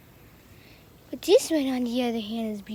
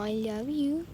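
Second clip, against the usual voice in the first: normal talking voice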